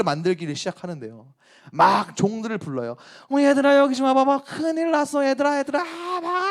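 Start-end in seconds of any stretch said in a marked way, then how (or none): 3.3-6.4 urgent calling out. Acted. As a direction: sound the alarm to the household. Wife calls servants urgently. (다급하게 종들을 부르며)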